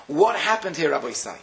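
usual speaking voice

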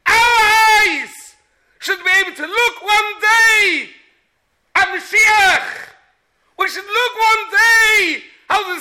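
high, loud rising sermon cry; fiery preaching. Acted. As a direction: preach it out with full fervour. Preaching at full force.

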